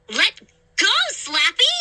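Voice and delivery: shrieking. A narrator's reading. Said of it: "I shrieked".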